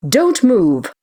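high, loud commanding shout; authoritative yell. A narrator's reading. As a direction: yell it out as an order to freeze. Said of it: "yelled out in my most authoritative voice".

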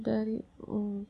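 ordinary delivery

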